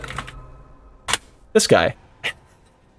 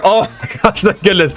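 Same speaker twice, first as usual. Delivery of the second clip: laughing with relief, pitched up high; breathless relieved laughter as the code arrives; 7 semitones higher than usual. Retold or imitated. To the bouncer, laughing in relief as the code arrives.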